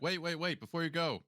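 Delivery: high, urgent call to stop them; wait, wait, hurry. Acted. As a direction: call them back before they leave. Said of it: "He's like".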